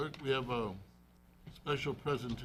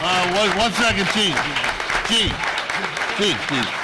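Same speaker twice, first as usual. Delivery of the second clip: loud, higher call over applause; 6 semitones higher, 14 dB louder than usual. Real, not acted. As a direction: call someone back before they leave. Mayor calls chief back over applause.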